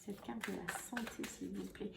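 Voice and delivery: very soft, under the breath; muttered aside. Real, not acted. Tarot reader asking for the health card, muttered very softly. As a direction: ask it quietly, almost to yourself. Muttered request while drawing cards.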